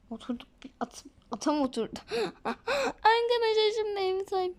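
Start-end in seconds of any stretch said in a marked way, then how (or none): 2.1-4.5 laughing. Real, not acted. Laughs at game moment.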